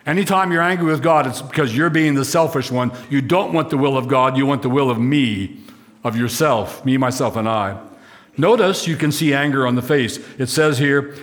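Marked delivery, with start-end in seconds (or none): none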